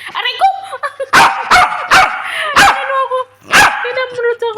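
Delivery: high, loud startled cry; real yelp. Real, not acted. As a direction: yelp as the pet bites. Pet attacks her.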